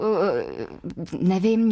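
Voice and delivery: ordinary talk, nothing marked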